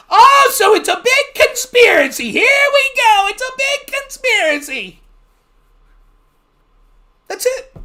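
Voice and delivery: hammed-up smug debater; sneering leftist. Mocking a leftist debate opponent, high and sneering.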